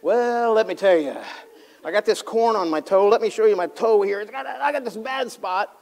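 hammed-up moaning about a sore toe; whiny complainer. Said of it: "you don't ever ask them".

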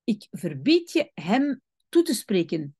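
ordinary delivery

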